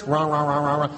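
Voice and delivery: low mock grumble, on one note; imitated grumbling. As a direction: grumble along like a churchgoer complaining. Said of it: "That's what it sounds like".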